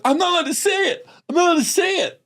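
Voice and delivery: put-on high, fast, fretful girl's voice; girl too shocked to repeat it; scandalized little girl. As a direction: refuse in a tattling whine. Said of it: "And she goes".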